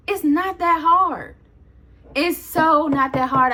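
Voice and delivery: slow, drawn-out exasperation; emphatic, slowed-down pleading; exasperated insistence. Real, not acted. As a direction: stretch each word to drive it home.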